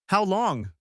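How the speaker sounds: high, pointed demand. A narrator's reading. Betrayed husband staring at his wife, demanding. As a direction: demand an answer, pitch jumping up. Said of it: "I demanded, staring at her".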